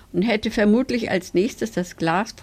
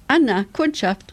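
higher, mildly raised storybook call; 6 semitones higher, 3 dB louder than usual. A narrator's reading. Tick-Tack fetching Anna for a customer, calling out. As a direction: call through to the next room. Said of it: "rief er laut".

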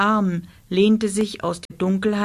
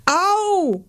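very high, drawn-out yowl; howl of pain; 11 semitones higher, 3 dB louder than usual. A narrator's reading. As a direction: yowl like a hurt monster. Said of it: "jaulte es".

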